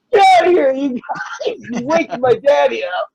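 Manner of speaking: put-on high-pitched little-kid holler; imitated boy's indignant yell; child yelling. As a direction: scold strangers like a small boy turning to shout.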